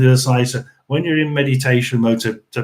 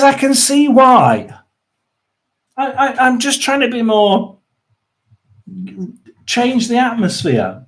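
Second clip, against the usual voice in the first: squeaky-high, wounded protest; high-pitched self-defence; 9 semitones higher than usual. Real, not acted. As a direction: plead your case right up in a high register. Defends his voice after being mocked.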